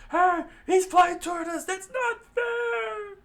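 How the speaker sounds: loud, very high wail; screamed alarm. A narrator's reading. Pirate crew screams as he flies at them, wailing high. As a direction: shriek in protest, stretching the last word. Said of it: "screamed out".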